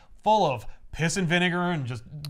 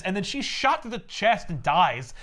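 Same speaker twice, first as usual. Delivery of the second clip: ordinary talk, nothing marked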